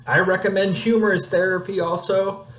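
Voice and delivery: high-pitched playful character voice; comic put-on voice. Recommending humorous therapy to viewers, high comic voice. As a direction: pitch it way up as a silly voice. Amateur voice acting, joking about humor.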